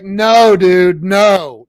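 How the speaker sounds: loud, high retold "no"; emphatic refusal. Refusing the party call, acted.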